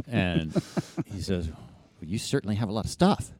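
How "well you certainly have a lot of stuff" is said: lightly voiced dry runner, a touch higher; a hint of a wry veteran sizing him up; impression of Remke. Runner Remke eyeing his gear, lightly voiced dry remark. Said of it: "That was a good impression".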